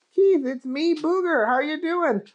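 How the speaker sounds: high cheerful character voice; a bright, friendly goofy guy; Booger voice. Acted. Booger's voice in story.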